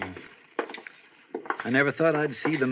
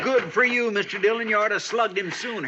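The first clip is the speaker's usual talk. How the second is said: excited shout; 9 semitones higher than usual. Acted. Gunfight, deputy calling out.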